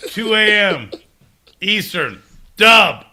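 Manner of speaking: rowdy bar shout. Real, not acted. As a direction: yell the time out. Drunk rant, calling out.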